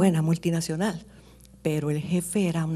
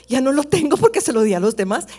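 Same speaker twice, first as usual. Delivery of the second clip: loud, high, giddy voice, laughing through it; the preacher mimics a woman who gave away her pay; excited woman; 7 semitones higher, 8 dB louder than usual. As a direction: play a woman carried away by excitement, fast and breathless.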